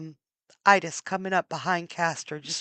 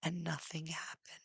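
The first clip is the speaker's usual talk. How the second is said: hushed whisper of failure; 3 semitones lower, 15 dB quieter than usual. A narrator's reading. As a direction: drop to a bare whisper. Story's lowest moment.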